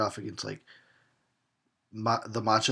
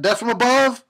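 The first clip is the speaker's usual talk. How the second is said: higher, much louder shout; mock battle cry; 12 semitones higher, 10 dB louder than usual. Cheering the killer's drop from above with a loud cry. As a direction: announce the attack like a wrestler.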